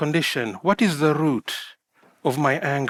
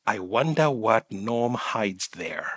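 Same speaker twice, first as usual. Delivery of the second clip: softer, slower private musing; curious inner voice; 10 semitones lower, 8 dB quieter, slower than usual. Retold or imitated. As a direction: wonder it quietly to yourself. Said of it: "you're probably wondering".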